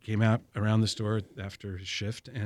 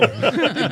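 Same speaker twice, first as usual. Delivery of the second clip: quick, amused laugh; a real laugh at the question; 9 semitones higher, 7 dB louder than usual. Real, not acted. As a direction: let a laugh slip out. Laughing after answering the question.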